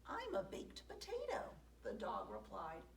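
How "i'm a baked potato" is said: very high, laughing little dog; high squeaky voice. Acted. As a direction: squeak it out with a giggle.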